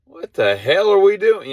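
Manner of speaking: high, loud panicked horse voice; mock panicked horse. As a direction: shriek it like a spooked horse. Horses voiced in riot scene.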